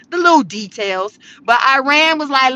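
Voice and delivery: ordinary talk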